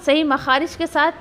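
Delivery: normal talking voice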